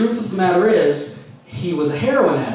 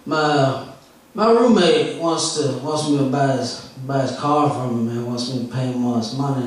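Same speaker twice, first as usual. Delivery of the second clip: impression of stoned comic; 5 semitones lower than usual. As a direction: sink into a dazed, nodding-off junkie voice. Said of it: "I'm going to do his set for you right now, verbatim".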